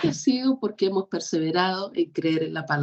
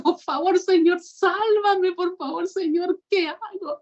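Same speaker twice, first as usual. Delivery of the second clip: high, pleading cry to God, slow on sálvame; desperate cry for help; 9 semitones higher than usual. Retold or imitated. Preacher reenacting her plea to the Lord, high and desperate. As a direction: beg the Lord to save you, voice pitched up. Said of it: "gritando auxilio".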